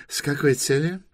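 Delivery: high official's question; interrogating inspector. Acted. Inspector asks Торрен where they come from, officious.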